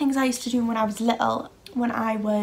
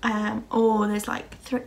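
usual speaking voice

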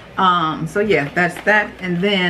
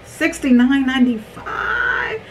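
real high-pitched price shock, drawn out at first; voice gets high; 8 semitones higher than usual. Real, not acted. Vlogger reacting to a steep price, voice going high. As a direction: let the price send your voice climbing high. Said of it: "my voice gets high".

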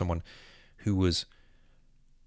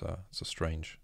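usual speaking voice

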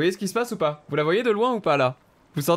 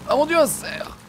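sudden much higher startled cry; surprised exclamation; 13 semitones higher, slower than usual. Real, not acted. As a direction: gasp it out in surprise. Spots a deer suddenly.

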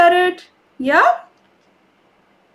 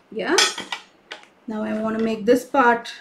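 ordinary delivery

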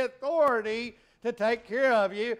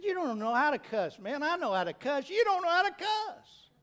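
retold boast; 6 semitones higher than usual. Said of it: "I said".

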